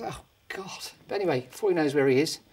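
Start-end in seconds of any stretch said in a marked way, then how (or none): 0.0-0.9 whispered, dismayed groan; despairing whisper. Acted. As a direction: breathe it out in dismay. Dave told he'll be chicken.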